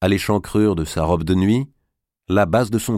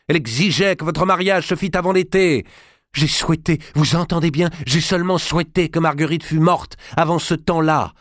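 growing fury; 9 semitones higher than usual. Acted. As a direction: hurl the blame at her. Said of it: "ne fit qu'accroître sa fureur".

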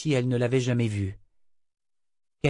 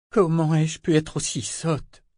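slow, moaning self-reproach; 5 semitones higher than usual. A narrator's reading. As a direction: groan it out, slow and broken. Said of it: "gémit-elle". (gémissement lent, plein de reproche)

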